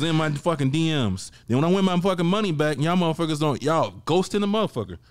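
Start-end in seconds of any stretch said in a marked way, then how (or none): none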